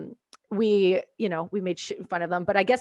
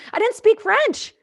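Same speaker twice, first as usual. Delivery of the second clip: much higher, slow squeaky admission; comic reveal; 13 semitones higher, 5 dB louder, slower than usual. Real, not acted. Speaker admitting she spoke no French, high squeaky reveal. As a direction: reveal it with disbelief.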